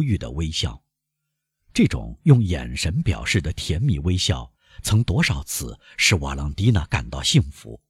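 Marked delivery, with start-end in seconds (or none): none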